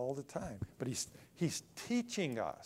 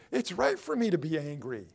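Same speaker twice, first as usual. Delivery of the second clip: Jonah's angry retort; 4 semitones higher, 8 dB louder than usual. Retold or imitated. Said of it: "he said".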